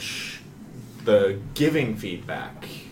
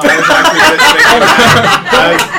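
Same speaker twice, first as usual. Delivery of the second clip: high, loud, laughing; 16 dB louder than usual. Real, not acted. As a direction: crack up while talking. Group laughing at joke.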